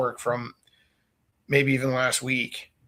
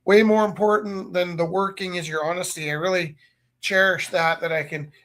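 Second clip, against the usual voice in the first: higher, hammed-up sincere thanks; mock gratitude; 6 semitones higher, 4 dB louder than usual. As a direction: lay the praise on thick. Teacher's mock praise of honesty.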